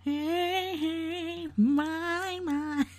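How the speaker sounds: high, soft whiny singing imitation. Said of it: "you have to do it in the whiny [name] voice".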